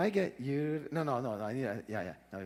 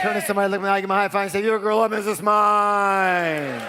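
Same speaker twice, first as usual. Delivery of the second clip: rousing crowd call; 6 semitones higher, 8 dB louder than usual. Real, not acted. Leader sending audience into high fives, rousing shout. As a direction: fire up the room at full volume.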